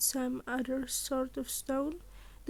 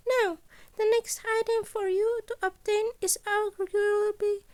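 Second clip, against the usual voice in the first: high, louder principal laying out the heist; Nezu voice; 8 semitones higher, 5 dB louder than usual. Acted. Principal briefing students on the gala heist, high and bright. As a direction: pitch it up like a cheerful small headmaster.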